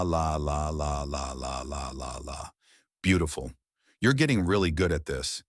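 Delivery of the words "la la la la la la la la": tongue warm-up la, fading softer; big tongue flicks on each la; exaggerated la la. Shown as a demonstration. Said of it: "exaggerated la".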